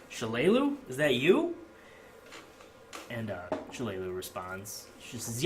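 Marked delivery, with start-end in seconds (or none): 0.1-1.6 higher voice with steep questioning rises; surprised recognition, swooping up at each end; puzzled, just-woken mayor. Acted. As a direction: call out uncertainly to a late visitor. Sleepy mayor peers at late visitor.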